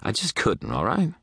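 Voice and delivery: high, flustered admission; defensive admission. A narrator's reading. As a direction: voice climbing sharply on "all right". Defensive admission under pressure.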